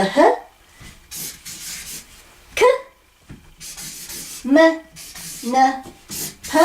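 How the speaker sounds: h, k, m, n, p, each alone as a short sound; isolated consonant sounds with long pauses between. Shown as a demonstration. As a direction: sound out h, k, m, n, p one at a time. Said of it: "how to pronounce these".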